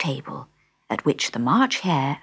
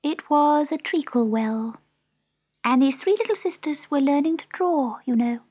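high, small mouse voice; sleepy Dormouse; 11 semitones higher than usual. A narrator's reading. The Dormouse resuming after a pause, high and drowsy. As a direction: tell it drowsily in a squeak.

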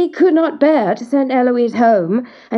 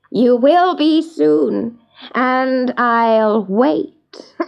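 very slow, drawn-out menace; gloating dwarf; 2 semitones higher, 3 dB louder, much slower than usual. Acted. Dwarf promises Eloise he will wait, slowly gloating. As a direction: stretch the words with wicked relish.

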